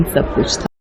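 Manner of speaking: plain reading voice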